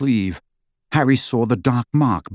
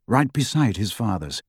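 ordinary delivery

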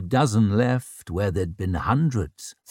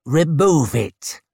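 stern command; 5 semitones higher, 4 dB louder, slower than usual. A narrator's reading. Warrior orders witch to clear mist.

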